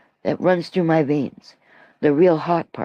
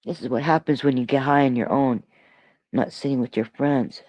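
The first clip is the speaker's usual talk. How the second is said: normal talking voice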